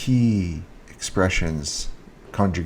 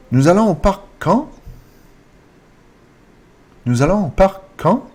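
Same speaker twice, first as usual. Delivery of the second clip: higher, louder question with "quand" swept up; rising intonation; 9 semitones higher, 5 dB louder than usual. Shown as a demonstration. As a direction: raise "quand" sharply at the end. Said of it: "It's a rising intonation".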